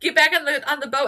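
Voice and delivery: hammed-up urgent command; mock calling out. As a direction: call a runaway pet back, playfully. Voices people calling the cat back.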